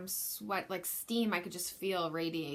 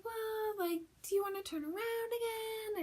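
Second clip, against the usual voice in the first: much higher, light sweet midwife voice; mimicking the midwife. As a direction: float it up like a gentle nurse. Midwife asking her to turn.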